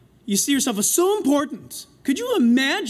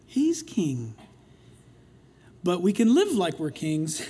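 ordinary delivery

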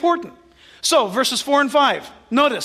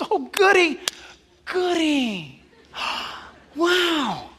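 mock delighted God; 4 semitones higher, much slower than usual. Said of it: "You think God goes".

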